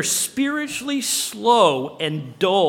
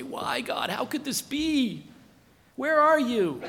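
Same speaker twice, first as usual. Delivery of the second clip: acted bewildered questioning, rising higher; doubting plea; 6 semitones higher than usual. Doubters asking God when things go wrong, acted plea. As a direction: plead upward in mock dismay. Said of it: "We say".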